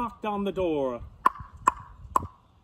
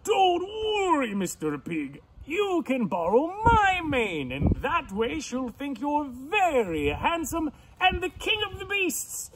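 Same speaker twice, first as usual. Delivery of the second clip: grand, raised lion voice; reassuring lion; 9 semitones higher than usual. Acted. Lion offers pig his own mane, grand and reassuring. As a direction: comfort him with swooping, hearty confidence.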